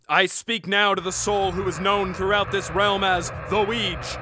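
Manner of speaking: solemn sorcerer summoning the dead; necromancer incantation. Acted. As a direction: intone it like a dark ritual.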